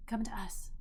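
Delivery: soft unvoiced whisper. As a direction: breathe it out under your breath. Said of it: "and Richard says".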